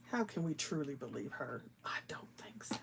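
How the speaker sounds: teasing whispered aside; conspiratorial whisper. Real, not acted. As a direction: lean in and whisper the doubt. Teasing aside about Renee.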